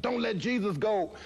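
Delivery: very high desperate plea; desperate cry. Retold or imitated. Fighter begging the fading voice, desperate cry. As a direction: cry out not to lose him. Pleading shout in vision.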